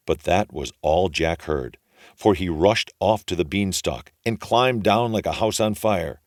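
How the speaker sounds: usual speaking voice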